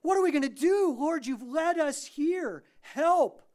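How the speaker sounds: high, loud cry to God for help; desperate crying out. Acted. Moses cries out to the Lord at the bitter water, desperate shout. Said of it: "my guess would be cry out".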